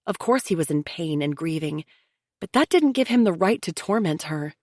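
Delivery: ordinary delivery, nothing marked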